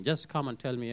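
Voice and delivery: usual speaking voice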